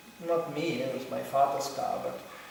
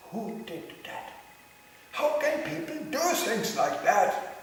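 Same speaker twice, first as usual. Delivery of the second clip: higher, slow, indignant mock outrage; upset neighbour; 13 semitones higher, 4 dB louder, much slower than usual. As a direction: play the outraged Swede, drawn out high. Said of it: "he gets really upset".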